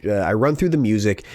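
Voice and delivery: normal talking voice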